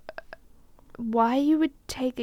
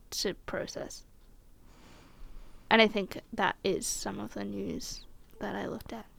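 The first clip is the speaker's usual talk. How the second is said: hushed whisper; 3 semitones lower, 11 dB quieter than usual. Real, not acted. Processing heavy news.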